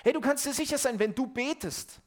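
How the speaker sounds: höher und lauter predigen, mit Nachdruck; forceful preaching. Acted. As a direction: proclaim it to the congregation. Preaching climax, louder. (eindringliche, laute Predigt)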